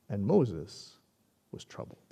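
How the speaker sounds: low, slow, dropping to a whisper on troubled; hushed scripture ending; fading to whisper. Acted. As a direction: let the verse fall away to a whisper. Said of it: "And then we read this".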